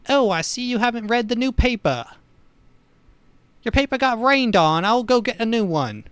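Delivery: high, sunny helpful cop, lightly British; a hint of a cheery small-town constable; nice neighborhood cop. Neighbourhood cop offering a new paper, high and cheery. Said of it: "he's a nice little neighborhood guy".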